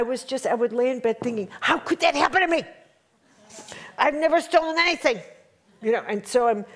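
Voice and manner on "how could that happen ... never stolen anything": much higher, fast and wounded; high pitched outraged lament; indignant disbelief. Retold or imitated. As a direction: cry out at the unfairness of it. Retold indignant thoughts in bed.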